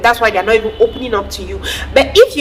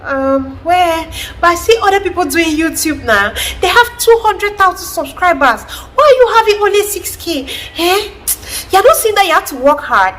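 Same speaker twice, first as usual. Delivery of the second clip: dismissive mother; 9 semitones higher than usual. Retold or imitated. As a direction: belittle the child's win, mocking. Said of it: "your mother is like".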